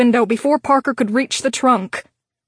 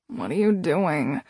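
lower, softer, half-asleep; groggy mumble; 6 semitones lower, 6 dB quieter than usual. A narrator's reading. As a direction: mumble it like just woken up. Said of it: "he mumbled in a groggy voice".

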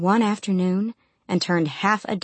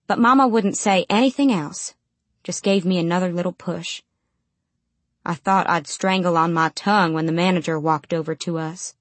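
ordinary delivery, nothing marked

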